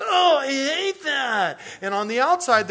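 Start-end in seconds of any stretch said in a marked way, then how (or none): 0.0-1.6 high, loud, slow drawn-out disgust; inner disgusted whine. Acted. Adult's hidden thought about the food, high slow groan. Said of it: "inside they're going".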